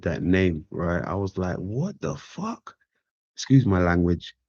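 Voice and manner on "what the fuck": high, slow, hushed disbelief; drawn-out incredulous whisper; stunned what the. Retold or imitated. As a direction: gasp it out in mock shock. Shocked reaction on hearing name.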